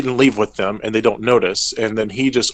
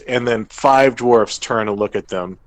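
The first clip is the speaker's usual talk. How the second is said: ordinary talk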